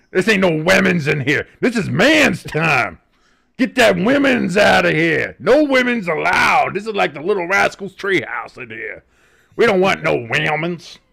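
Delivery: loud, slow, hammed-up Southern drawl; mock Southern accent. Podcaster mocks co-host Hamster with a loud, hammed-up Southern accent. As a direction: play a brash Southern good ol' boy keeping women out. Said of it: "the dubious Southern accent".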